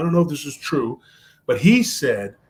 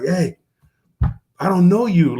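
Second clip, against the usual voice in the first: mildly angry, louder challenge; retold anger; 3 semitones higher, 5 dB louder than usual. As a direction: imitate a man getting mad, raising the voice. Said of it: "he gets all mad".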